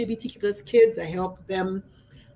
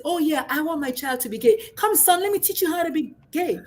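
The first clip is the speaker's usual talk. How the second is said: sarcastic singsong, pitched up, mocking the idea; mocking sarcastic parent; 6 semitones higher than usual. Coach mocking claims that parents groom their kids, sarcastic voice. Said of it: "Like, I'm just gonna say".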